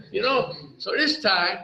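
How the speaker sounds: ordinary talk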